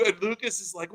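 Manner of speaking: ordinary delivery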